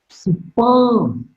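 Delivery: "Superb" pitched high, then falling. Shown as a demonstration. Teacher showing an exclamatory word, falling tone on "Superb". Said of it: "Superb is marked with a falling tone".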